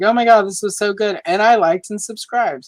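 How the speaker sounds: high, loud excited gushing; gushing fan. Retold or imitated. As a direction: read it like a thrilled fan comment. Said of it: "I've gotten comments like".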